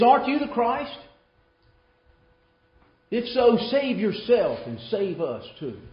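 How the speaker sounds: loud, high, challenging taunt; hurled insults. Acted. Thief on the cross to Jesus, loudly hurling insults. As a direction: throw it hard at him as a demand. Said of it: "one begins to hurl insults at him".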